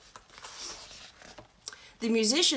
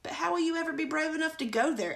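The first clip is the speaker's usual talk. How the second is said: quick, higher frightened hedgehog; scared; 7 semitones higher, faster than usual. Acted. Hedgehog fearing the fairy, quick and frightened. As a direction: rush it out nervously. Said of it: "asked the hedgehog in a scared voice".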